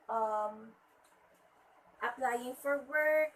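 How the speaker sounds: ordinary talk, nothing marked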